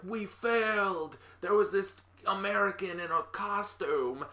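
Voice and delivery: hammed-up desperate minions; panicked henchmen. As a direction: wail it like scared teen goons reporting back. Teen henchmen reporting failure to boss.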